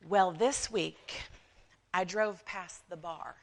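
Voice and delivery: slow, drawn-out put-on accent; Mildred's put-on accent. Church gossip telling what she saw, slow put-on accent. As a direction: draw it out in a mock accent. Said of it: "I don't know why Mildred has an accent".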